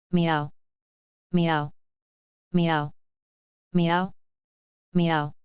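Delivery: sulky cat meows. Acted. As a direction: meow back like a sulky cat.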